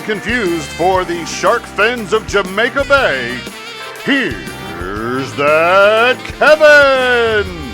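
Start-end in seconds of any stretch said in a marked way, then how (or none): 4.0-7.7 hugely stretched, swooping host introduction; announcer's big intro. Acted. Announcer bringing Kevin on stage, long drawn-out fanfare call. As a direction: drag out each word into a rising showbiz call.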